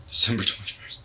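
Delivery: December twenty-first whispered ominously, no voice. Acted. Conspiracy nut whispering the doomsday date. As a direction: breathe the date like a dark secret.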